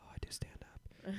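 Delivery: sheepish whisper; telling the guide he does stand-up, whispered; whispered admission. Retold or imitated. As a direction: confess it under your breath.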